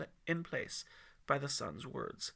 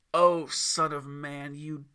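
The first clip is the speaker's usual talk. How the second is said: mocking devil; 8 dB louder than usual. A narrator's reading. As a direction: sneer at his weakness. Lucifer mocking the fasting man.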